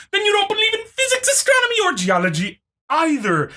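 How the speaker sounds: high, fast whiny parody; mocking the opponent. Quoting the opponent's claim in a mocking whine. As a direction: squeak it out like a fretting commenter.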